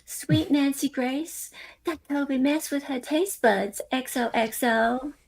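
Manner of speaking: high, slow sugary sign-off voice; mock gushing. Panelist mocking Becca's gushing email, high and syrupy. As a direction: drawl it out sweetly in mockery.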